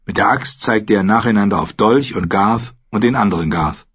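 usual speaking voice

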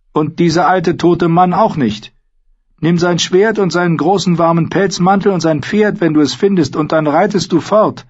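put-on high-pitched, loud old woman. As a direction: speak up high as an old crone.

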